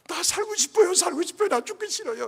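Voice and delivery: put-on high, pleading child voice; child's desperate plea. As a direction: beg to live like a frightened child. Dying child begging to live. (살고 싶다고 애원하는 높은 아이 목소리)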